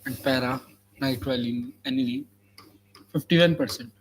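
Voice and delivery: very slow, word-by-word dictation; dictating. Shown as a demonstration. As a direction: spell it out slowly for copying. Dictating notes to students.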